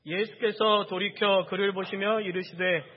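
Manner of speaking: loud, slow, measured unison-style reading; scripture reading aloud. Real, not acted. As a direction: lead the congregation through the verse. Leads congregation in reading scripture.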